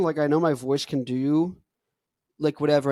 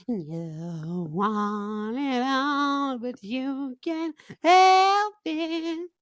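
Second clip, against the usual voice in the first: slow, high, drawn-out cartoon singing voice; silly voice; 9 semitones higher, much slower than usual. Shown as a demonstration. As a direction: sing it in a goofy put-on voice. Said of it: "if you're just doing silly voices".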